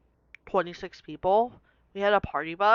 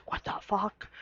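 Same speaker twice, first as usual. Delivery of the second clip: soft, breathless whispered what the fuck; whispered disbelief; 7 dB quieter than usual. Retold or imitated. To herself in history class, a whispered disbelief. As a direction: whisper it in shock.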